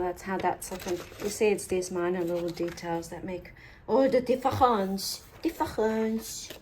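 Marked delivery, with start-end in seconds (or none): 1.2-5.2 put-on light French accent on the tutorial line. Crafter drifting into a mock French accent mid-tutorial. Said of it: "I've moved on to French accents now". 5.4-6.5 slow, drawn-out French "Difference."; French accent. Pretend. Crafter echoing the word in a slow, hammed-up French accent. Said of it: "sidetracked by my French accent".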